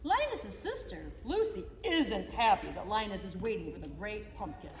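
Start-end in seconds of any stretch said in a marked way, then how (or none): none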